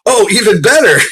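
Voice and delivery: high, loud laughing even better; laughing gloat. Retold or imitated. American teasing the Brit, high laughing. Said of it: "I was like".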